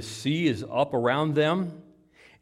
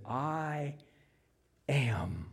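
soft, drawn-out words with a pause between; hushed solemn declaration; 8 dB quieter than usual. Acted. Jesus naming himself to disciples, hushed. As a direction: breathe it out quietly. Quoting the divine answer, spaced out.

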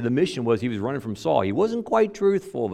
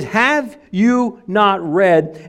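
slow, higher, louder mock question; sarcastic; 6 semitones higher, 4 dB louder, much slower than usual. Jesus challenging scholars who memorized everything, sarcastic. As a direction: needle them with it. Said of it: "sarcasm in his voice".

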